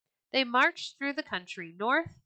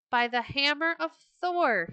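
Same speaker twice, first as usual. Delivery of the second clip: a king roaring with delight; joyful oath; 6 semitones higher than usual. A narrator's reading. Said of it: "he cried".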